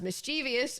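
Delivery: "mischievous" as mis-CHEE-vee-us; common mispronunciation. Shown as a demonstration. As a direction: add an ee before the last syllable of "mischievous", mis-CHEE-vee-us. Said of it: "There is no dictionary that has".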